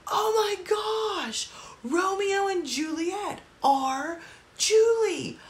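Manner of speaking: high, slow, drawn-out shout of discovery; a sudden high shriek of realization; excited scream. Retold or imitated. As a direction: scream it out like you just cracked the puzzle. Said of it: "I, like, just screamed out".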